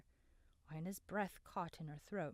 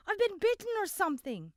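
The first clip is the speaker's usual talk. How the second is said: much higher and louder, alarmed; panicked yell; 13 semitones higher, 10 dB louder than usual. A narrator's reading. Cale tells Abram he has been bitten, loud and panicked. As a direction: cry out in a sudden panic.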